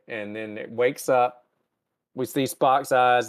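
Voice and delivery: ordinary delivery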